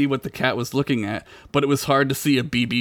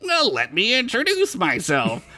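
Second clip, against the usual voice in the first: nerdy voice; 10 semitones higher than usual. Acted. Lute nerd character speaks.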